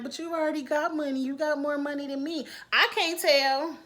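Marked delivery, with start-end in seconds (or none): 0.0-2.5 higher, whiny put-on voice; mock whining. Mocking Bailey whining about Dee's money, mock whiny. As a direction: whine it like a spoiled kid. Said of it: "don't start whining like".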